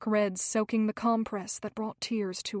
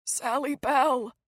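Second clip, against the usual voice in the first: choking; 3 semitones higher than usual. A narrator's reading. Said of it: "a strange choking sound".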